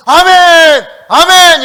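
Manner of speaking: very high, loud, sustained cry; full-throated amen shout. Retold or imitated. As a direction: imitate worshippers roaring their amens. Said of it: "그냥 아멘도 아니고".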